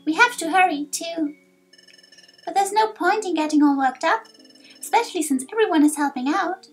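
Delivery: high, wistful youngster voice; earnest young hero. Acted. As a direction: think aloud as a little adventurer, then reassure. Young hero reflects at bedtime.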